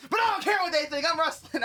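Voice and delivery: loud, high burst of defiance; mock defiant outburst. Wrestler overruling family and doctors, mock defiant shout. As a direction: imitate a sudden stubborn declaration.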